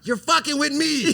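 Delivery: Matt Damon impression. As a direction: yell it like the film scene, hammed up. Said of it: "like Matt Damon and Good Will Hunting".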